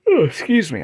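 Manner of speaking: yawning apology. Real, not acted. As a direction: talk through a yawn. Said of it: "I'm sorry I'm yawning".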